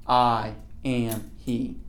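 slow, weighty "I am he"; slow emphatic. Shown as a demonstration. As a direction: let each word land slowly. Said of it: "putting an emphasis on I".

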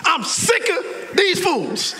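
slow, high exasperated cry; hammed-up fury, high and stretched; mad. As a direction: erupt in drawn-out frustration. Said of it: "he was so mad".

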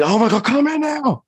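very high, urgent mock panic; panicked recall. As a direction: act out desperately calling the dog back. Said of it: "in the moment of necessity".

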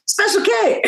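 high-pitched incredulous punchline; amused disbelief. Retold or imitated. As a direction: squeal it in mock amazement. Retold astonished punchline of story.

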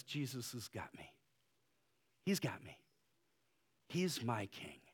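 soft, hushed voice of a widow trusting Jesus; a softened, whispered-down confession of trust; quiet surrender. Retold or imitated. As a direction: breathe it out gently, as a widow's prayer. Widow's surrendered prayer quoted.

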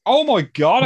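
high, loud gasp of disbelief; surprised. Real, not acted. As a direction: blurt it out in shock. Shock at thirty-seven wigs.

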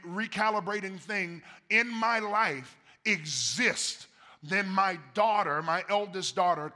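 full-voiced sermon build; preaching. Real, not acted. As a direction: preach it with rising force.

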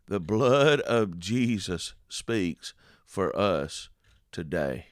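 drawn out slowly, each word weighted; solemn slowed proclamation; slow emphatic repeat. Shown as a demonstration. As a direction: let the words land one by one. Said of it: "slow emphatic repeat".

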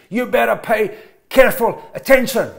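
blunt warning. Shown as a demonstration. Preacher contrasting a blunt order with scripture, stern loud command. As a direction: bark the warning at the congregation.

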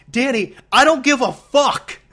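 loud, high imitation of a mad teammate; mock-furious retelling at high pitch; mad outburst. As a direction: act out his exasperated blow-up, drawn out and loud. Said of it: "he ended up getting mad about it".

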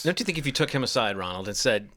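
ordinary delivery

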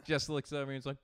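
normal talking voice

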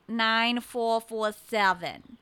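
slow New England digits; deep New England number-reading; deep New England accent. Retold or imitated. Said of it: "in this deep New England accent".

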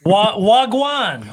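high, drawn-out Jamaican "Wagwan"; Jamaican-style hello, stretched out; Jamaican patois greeting. Shown as a demonstration. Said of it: "in Jamaican".

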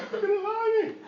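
put-on child protesting.

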